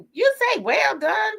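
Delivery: high, slow mock disbelieving friend; mock disbelief. A friend questioning her well-done order, mock disbelief. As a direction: ask it like you can't believe it. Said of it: "My friend was like".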